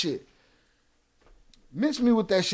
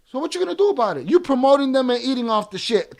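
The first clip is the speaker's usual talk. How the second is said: higher and heated; angry challenge; 6 semitones higher, 7 dB louder than usual. Real, not acted. Streamer challenges chat, angry. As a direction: demand an answer.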